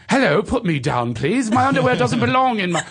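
high, mock-British pleading; hammed-up proper British victim; King's English. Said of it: "Here you go, King's English".

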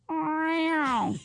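drawn-out falling cat meow; a playful retold cat meow; imitated meow. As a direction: mimic the cat calling from the fence.

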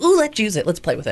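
excited. Retold or imitated. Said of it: "you're like".

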